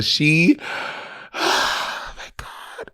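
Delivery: breathless sigh, then whispered awe; overwhelmed sigh. Real, not acted. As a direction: let it all out on a sigh. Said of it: "I was so excited".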